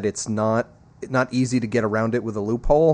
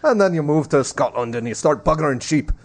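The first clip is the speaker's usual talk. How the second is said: mock Scottish accent; 2 semitones higher than usual. Host jokes about moving to Scotland, mock Scottish accent. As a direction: put on a cartoon Scottish accent.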